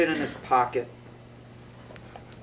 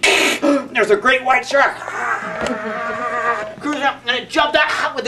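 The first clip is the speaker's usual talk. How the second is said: dramatic alarm; 6 semitones higher than usual. A narrator's reading. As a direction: draw out the danger. Shark appears in story.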